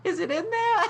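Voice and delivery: very high squeak through laughter; laughing mock girl voice; laughing replay. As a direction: squeak it out while cracking up. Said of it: "She went".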